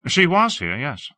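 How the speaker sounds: a lofty, admiring high voice; Hawke, airy and effusive. Acted. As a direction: gush about the city grandly.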